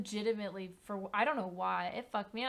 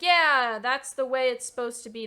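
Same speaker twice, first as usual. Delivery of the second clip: loud, overeager yes; mock eager agreement; 4 semitones higher, 13 dB louder than usual. Streamer mocking her younger self, loud mock eager agreement. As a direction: blurt it out like a naive kid.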